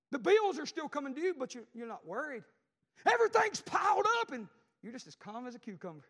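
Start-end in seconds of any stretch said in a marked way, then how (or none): 0.1-4.3 preaching build. Acted. Preaching crescendo.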